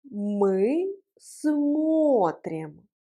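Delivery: extremely slow, long held vowels; slow model pronunciation. Shown as a demonstration. Teacher leading learners through the conjugation, very slow model. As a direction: stretch both words right out. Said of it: "Prononçons ensemble la conjugaison".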